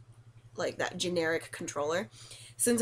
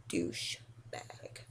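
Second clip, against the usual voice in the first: much lower, soft whispered jab; whispered insult; 10 dB quieter, much slower than usual. Real, not acted. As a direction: breathe the insult under your breath. Muttered insult after remark.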